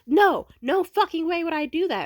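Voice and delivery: high, loud indignant retort; outraged denial. Retold or imitated. Ex denying it over the phone, high indignant shout. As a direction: fire back in disbelief.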